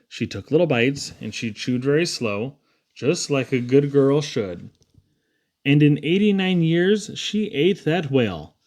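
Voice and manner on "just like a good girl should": plain reading voice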